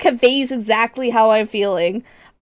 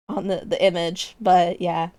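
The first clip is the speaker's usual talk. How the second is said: ordinary talk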